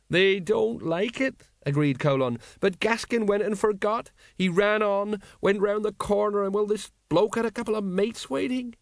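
mild tipsy grief; sorrowful sergeant. Acted. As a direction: mourn as you tell it. Colon recounting Gaskin's death.

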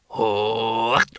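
low guttural roar; samurai challenge cry. Acted. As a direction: growl out a foreign war challenge.

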